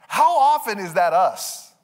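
ordinary talk

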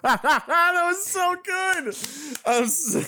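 cracking up in delight; laughing. Real, not acted. Host laughing, praising co-host's impression.

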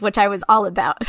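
normal talking voice

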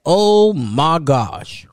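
very slow, drawn-out oh my gosh; overwhelmed sigh. Real, not acted. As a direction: let it sink in, exhausted.

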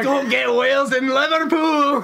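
high, loud, slow Liverpool imitation; mock Scouse echo. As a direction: repeat the line in a hammed-up Liverpool accent. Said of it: "Why have we made him Scouse?".

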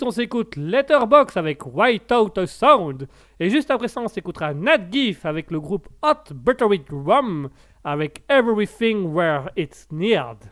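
put-on English titles read slowly in an English accent. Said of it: "t'es pas mal ce petit accent anglais". (annonce lente des titres avec un accent anglais)

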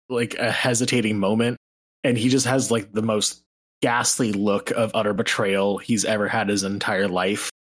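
normal talking voice

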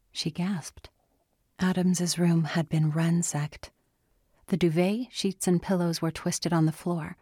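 plain reading voice

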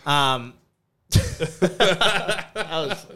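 words dissolving into laughter; laughing. Real, not acted. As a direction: laugh through it, slow and high. Cracking up at shared joke.

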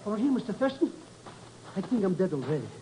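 softly, as if half dead; faint and dazed. Acted. Sidekick answers his boss after the jump, faint. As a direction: answer weakly from the ground.

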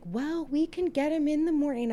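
high, prim little nun voice; light mocking sweet lady voice. Imitating calm nun.

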